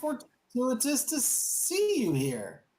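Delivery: drip false delight at the heroes; high, slow, smarmy noble voice; smarmy councilman. Acted. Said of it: "And [name] goes".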